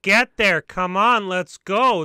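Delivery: loud, urgent calls of get there; calling out. Real, not acted. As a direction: hurry them in with loud shouts. Urging the car to arrive.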